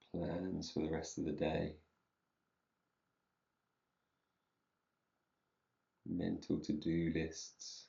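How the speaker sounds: soft, unhurried meditation voice with long pauses; guided meditation. Real, not acted. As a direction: ease them gently into stillness, leaving space between phrases.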